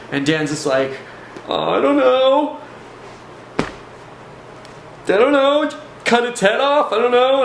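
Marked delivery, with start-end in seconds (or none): 1.5-7.4 very high, drawn-out mock wail; high, stretched, panicky imitation; imitated shaking panic. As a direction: act out a terrified man at a loss. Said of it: "totally shaking at this time".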